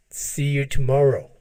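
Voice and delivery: ordinary delivery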